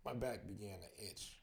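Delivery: plain reading voice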